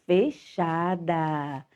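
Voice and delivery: fechada said slow, low and soft; closed sound shown. Shown as a demonstration. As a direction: draw out fechada as if closing the vowel. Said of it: "faz com que a vogal".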